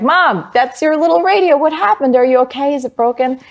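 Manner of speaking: high-pitched, distressed rush of questions; retold alarmed child. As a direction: cry out in dismay to your mother. Alarmed child seeing radio dismantled.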